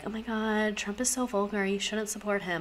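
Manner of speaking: soft mock-wife voice; apolitical wife. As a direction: imitate a mildly disapproving wife, quietly. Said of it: "that kind of wife who".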